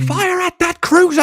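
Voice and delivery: high, loud, drawn-out battle order; mock commander's shout. Actor spoofing a film officer ordering an attack, hammed-up high shout. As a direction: bellow it like a film villain commanding fire.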